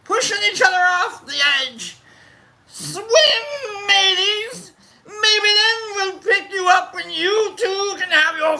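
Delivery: high, hammed-up pirate hollering; pirate captain. Shouting at fans overboard, hammed-up pirate voice. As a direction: call it out from the deck like a mad captain.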